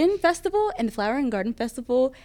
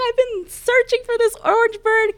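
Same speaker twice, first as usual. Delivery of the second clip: high and louder, a touch of teary excitement; emotional guest; 7 dB louder than usual. Retold or imitated. Custodian voicing the tearful guest who found her ears, high and emotional. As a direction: lightly play the overjoyed guest. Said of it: "this guest was in tears".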